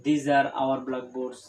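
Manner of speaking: very slow, word by word; teacher modelling a plural sentence slowly; slow model sentence. Shown as a demonstration. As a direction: spell out "These are my books" slowly. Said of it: "এগুলো আমাদের blackboard".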